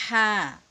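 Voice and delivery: light airy হা as Arabic ح; breathy thin ha. Shown as a demonstration. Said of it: "বাতাস বের করে বলতে হবে". (চিকন হা বাতাস বের করে)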